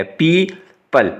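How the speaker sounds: पीपल said slowly with the ee stretched; slow long ee. Shown as a demonstration. Teacher stresses the long vowel in पीपल, slowly. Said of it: "इसको लंबा करना है आपने".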